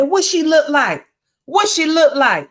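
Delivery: high-pitched mama demanding details; nosy mama. Acted. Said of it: "And the mama said".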